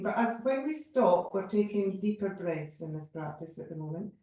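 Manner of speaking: usual speaking voice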